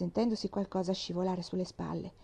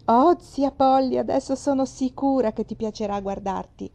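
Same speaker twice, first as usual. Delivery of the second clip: delighted; 4 semitones higher, 7 dB louder than usual. A narrator's reading. Girl showing her aunt the mirror, delighted and loud. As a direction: gush with pride.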